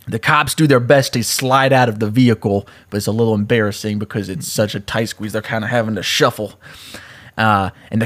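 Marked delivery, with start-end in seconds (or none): none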